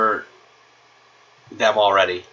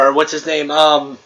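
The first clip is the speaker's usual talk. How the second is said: ordinary delivery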